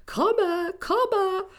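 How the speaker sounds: high, drawn-out call; high sing-out call. A narrator's reading. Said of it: "kept calling".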